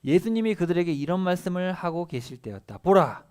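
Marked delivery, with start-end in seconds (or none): 2.8-3.2 보라 cried loud and high; emphatic proclamation. A narrator's reading. Teacher reading scripture aloud, loud emphatic 보라. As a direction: burst out with a commanding 보라.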